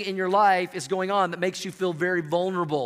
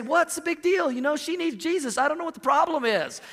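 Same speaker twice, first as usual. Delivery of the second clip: mock pleading husband; 8 semitones higher than usual.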